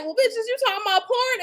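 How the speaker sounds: high, mocking accusation; mock accusing. The offended listener confronting the speaker, in a high mocking accusation. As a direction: throw the question at them like an accusation. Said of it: "you might be like".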